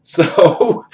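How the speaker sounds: high bursts of laughter; laughing. Real, not acted. Storyteller laughing at the twist, high bursts.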